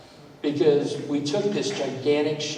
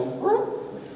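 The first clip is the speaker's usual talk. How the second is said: mock whoop. Reaction to legal talk.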